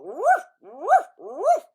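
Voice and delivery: loud, high mock woof; dog bark. Imitating dog.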